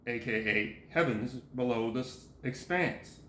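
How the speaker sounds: parenthetical aside. Real, not acted. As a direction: drop the pitch as if reading a bracket.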